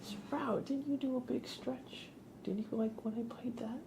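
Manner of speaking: sweet high voice for the pet; pet talk. Real, not acted. Talking to pet Sprout.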